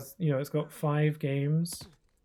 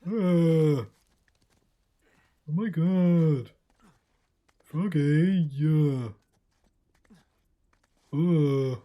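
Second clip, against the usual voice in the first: loud, raised, drawn-out cartoon cat calls; Big the Cat; 4 semitones higher, 6 dB louder, much slower than usual. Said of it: "I sound like Big the cat".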